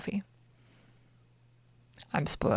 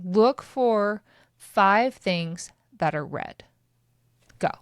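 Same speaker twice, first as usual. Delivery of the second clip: slow instruction; 3 dB louder, slower than usual. Shown as a demonstration. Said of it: "gives a listener exercise".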